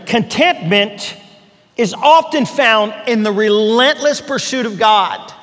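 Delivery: each word laid down slowly; slow and deliberate. Shown as a demonstration. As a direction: pastor stressing his key line to listeners, slowly weighted. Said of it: "Now hear that fresh and new".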